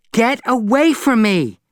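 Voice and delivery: loud high shout at the cat. Acted. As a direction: drive the cat off with a yell. Shoos the cat away.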